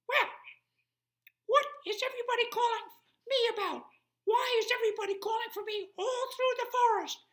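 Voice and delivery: very high, loud and fast squeak; squeaky mouse. Acted. Tiny mouse asking why everyone calls him, squeaky. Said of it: "a very squeaky voice".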